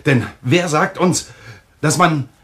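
high, heated and nervous; agitated. Acted. Brother-in-law ranting about police suspicion, high and agitated. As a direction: rant at the danger of suspicion.

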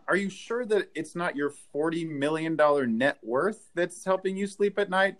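ordinary talk, nothing marked

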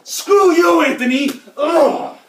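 high, loud snap at someone; angry outburst. Pretend. Enrique lashing out at Anthony after a prank, angry outburst. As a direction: burst out annoyed, ending in a grunt.

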